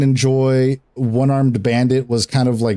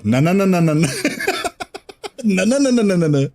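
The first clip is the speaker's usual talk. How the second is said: laughing mock protest; 6 semitones higher, faster than usual. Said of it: "he'd go".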